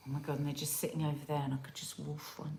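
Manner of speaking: hushed whisper. Real, not acted. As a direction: whisper it so nobody hears. Craving cookies nearby.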